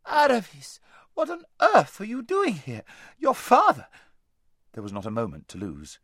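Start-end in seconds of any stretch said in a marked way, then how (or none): 0.1-3.9 high, loud, indiscreet outburst; top of her voice. A narrator's reading. Lazareline spotting her friend in the street, shouting. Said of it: "the top of her voice".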